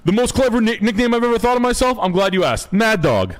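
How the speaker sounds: high-pitched, self-satisfied mock boast; boastful boomer. Boomer bragging about his nickname, mock boastful. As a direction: announce your own nickname with hammed-up pride. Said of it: "It's a big fat boomer".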